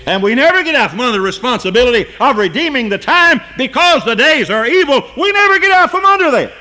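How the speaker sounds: high pulpit cry, climbing and loud at the phrase ends; fervent preacher in full voice; full-force preaching. Real, not acted. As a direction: build to a ringing cry. Preaches at full force, but for line 66.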